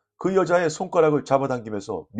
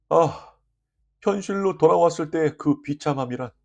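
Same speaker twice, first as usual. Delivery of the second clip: usual speaking voice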